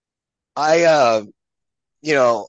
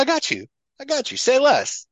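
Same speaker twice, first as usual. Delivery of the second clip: mock eager; 13 semitones higher than usual. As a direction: overplay the happy yes. Answering a request for a picture.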